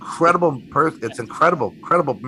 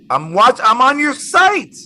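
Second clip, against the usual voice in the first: loud, high cry of disbelief; reenacted amazed yell; astonished outburst; 12 semitones higher, 8 dB louder than usual. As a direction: blurt it out high and loud, stretching the end. Retells his stunned reply to caller.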